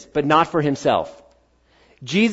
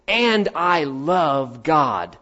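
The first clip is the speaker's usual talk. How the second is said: slow mock claim; much slower than usual. Mimicking a careless believer.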